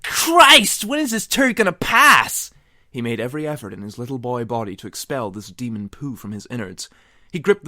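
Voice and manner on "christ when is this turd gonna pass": straining yell. Acted. Straining on toilet.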